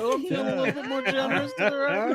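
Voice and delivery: high, quick mock voice for the TV viewer; playfully voicing a viewer turning the dial right; mock dial tester. Retelling test-audience joke, quick high playful voice. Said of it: "she's, like, going to the left".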